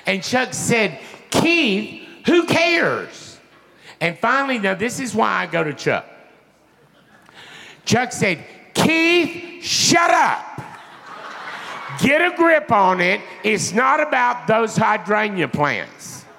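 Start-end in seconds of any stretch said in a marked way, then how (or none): 1.3-3.4 high, impatient mock outburst; exasperated rising cry; exasperated dismissal. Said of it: "he said it just like that". 8.7-16.2 high shouted telling-off; exasperated yell, easing toward the end; blunt scolding. Retold or imitated. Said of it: "he said it just like that".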